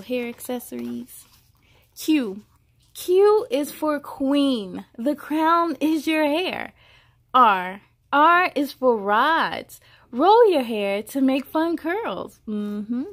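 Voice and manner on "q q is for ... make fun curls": put-on bright, measured read-aloud voice for a child; storybook reading. A narrator's reading. Reading a hair alphabet book aloud to a child, storybook voice. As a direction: read each line out like a picture book.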